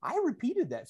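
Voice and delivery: high startled self-correction; caught-out realization. Retold or imitated. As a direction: blurt it like catching a slip.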